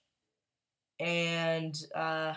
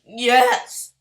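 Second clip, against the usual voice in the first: excited yes; 4 semitones higher, 10 dB louder than usual. Real, not acted. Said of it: "that was not a voice trick".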